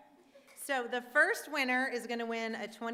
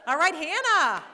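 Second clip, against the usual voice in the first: excited call; 6 semitones higher, 11 dB louder than usual. Real, not acted. Winner named.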